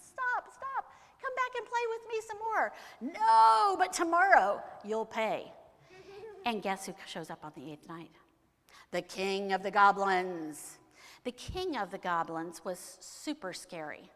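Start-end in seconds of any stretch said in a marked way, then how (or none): 0.0-2.7 very high, loud, teasing call; calling back. Acted. Herschel begs goblin to play more, high loud call. 3.0-5.5 loud, drawn-out angry threat; furious goblin refusal. Acted. Goblin vows revenge tomorrow, loud angry refusal. 8.9-10.8 slow, loud, ominous announcement; grand villain reveal. A narrator's reading. Storyteller announces the eighth night's visitor, slow and booming.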